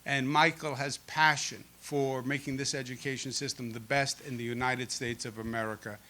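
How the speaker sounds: usual speaking voice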